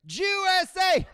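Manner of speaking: high, loud chant; hammed-up sports dad chant; imitating a yelling dad. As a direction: cheer it at the TV like a fan. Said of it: "He's yelling at the screen".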